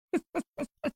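sudden high squealing laugh; high burst of laughter. Real, not acted. Host hears about cry rooms and squeals with laughter.

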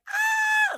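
very high mock scream; hammed-up shriek of a screaming kid; imitated child scream. Little boy just screaming in the car, imitated scream. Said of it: "Like, just screaming in the back seat".

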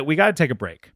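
usual speaking voice

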